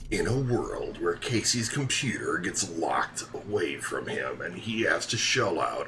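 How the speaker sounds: swooping, dramatic trailer voice; theatrical suspense voice-over; mock movie trailer narrator. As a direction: narrate it like a hammed-up film trailer.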